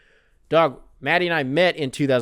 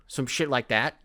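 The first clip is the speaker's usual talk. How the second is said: ordinary delivery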